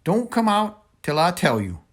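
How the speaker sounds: put-on an old woman pushing the children to safety. A narrator's reading. Momo Bay again.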